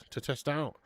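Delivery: usual speaking voice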